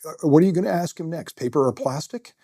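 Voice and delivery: sarcastic inner critic; mocking inner voice. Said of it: "I remember thinking".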